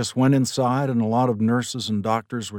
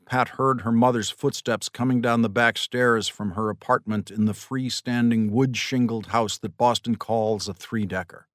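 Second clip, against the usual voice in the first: plain reading voice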